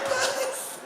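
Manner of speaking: very high cry of a lisping name; lisping call. Acted. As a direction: call out to a friend in alarm. Cyril calls crashed friend.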